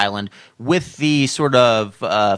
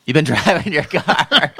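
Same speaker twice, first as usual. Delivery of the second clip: laughing; 3 semitones higher than usual. Real, not acted. Host amused by guest's joke.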